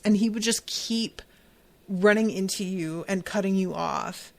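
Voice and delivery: usual speaking voice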